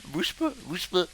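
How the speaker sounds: slow mocking echo of his voice; mocking imitation. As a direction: parrot his words back slowly. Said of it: "Pourquoi tu parles comme ça".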